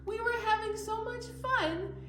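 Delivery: high, squeaky little tiger puppet; cheerful puppet voice. Pretend. Host voicing Tiger the puppet for the kids. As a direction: play the eager puppet friend.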